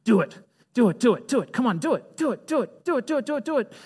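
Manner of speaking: whisper-egg them on like a devil on the shoulder; tempter on the shoulder. Retold or imitated. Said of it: "you hear that voice on your shoulder".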